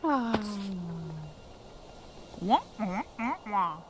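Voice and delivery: muffled trombone wah wah; Charlie Brown teacher. Pretend. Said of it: "Charlie Brown teacher".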